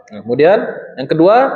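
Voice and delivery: normal talking voice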